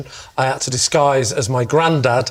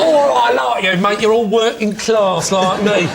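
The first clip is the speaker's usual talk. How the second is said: higher, matey northern voice; northern accent; 5 semitones higher than usual. Retold or imitated. To the electrician, chatting in a put-on northern accent. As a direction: play up the northern working-class accent. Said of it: "getting more northern".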